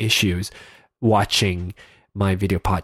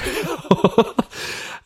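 breathy laugh; 10 semitones higher than usual. Real, not acted. Laughs at own stuffy nose.

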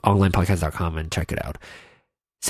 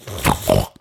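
low short laugh; 13 semitones lower than usual. Real, not acted. Laughs at stuffed nose.

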